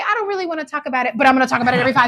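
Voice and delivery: high, whiny mock voice, rushed at times; squeaky over-eager fake reassurance; hammed-up high pretend calm; mocking Elias. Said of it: "So he's like".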